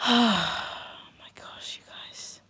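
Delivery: big sigh, then a near-whisper; exhausted dismay. Real, not acted. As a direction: sigh it out and let the voice sink away. Upset over losing all her files.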